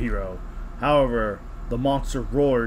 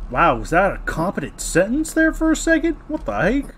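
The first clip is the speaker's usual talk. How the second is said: mock amazement; 6 semitones higher, 3 dB louder than usual. Real, not acted. Mocking a sudden good sentence.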